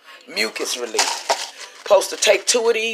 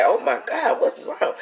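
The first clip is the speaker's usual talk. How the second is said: higher-pitched mock alarm; alarmed friend, imitated; 10 semitones higher, 5 dB quieter than usual. Friend reacts to a sick caller, imitated alarm. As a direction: lightly act out a startled friend. Retells worried friend on phone.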